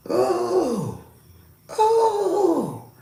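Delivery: very high, drawn-out "oh", falling off; imitated spirit's "oh". Spirit looking over a visitor, high imitated moans. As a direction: mimic high trance-like cooing. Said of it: "And he, ah".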